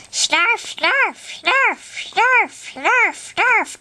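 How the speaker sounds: Snarf impression. Voicing Snarf from Thundercats, squeaky and repeated. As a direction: squeak the name over and over like the little Thundercats pet. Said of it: "I can kinda do Snarf from Thundercats".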